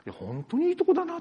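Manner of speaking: high, quick delighted exclamation; recalling a trip to Matsushima, high and excited. Retold or imitated. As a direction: relive the joy of the place, bright and fast.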